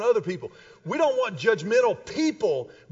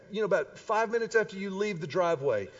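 ordinary talk

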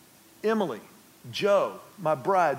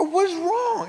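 much higher, incredulous; baffled; 11 semitones higher than usual. Retold or imitated. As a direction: ask in disbelief. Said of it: "I go".

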